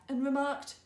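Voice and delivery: ordinary delivery, nothing marked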